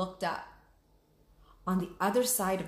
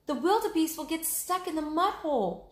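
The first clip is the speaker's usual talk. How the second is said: cried out; 9 semitones higher than usual. Acted. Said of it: "she cried".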